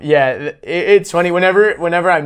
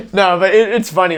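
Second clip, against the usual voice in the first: ordinary talk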